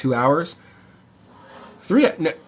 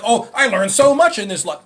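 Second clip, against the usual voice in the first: gushing commenter; 8 semitones higher, 6 dB louder than usual. Retold or imitated. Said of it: "everyone's like".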